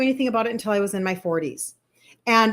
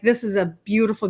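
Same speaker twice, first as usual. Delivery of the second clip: ordinary talk, nothing marked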